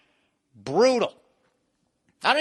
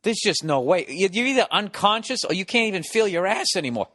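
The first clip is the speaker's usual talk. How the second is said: ordinary delivery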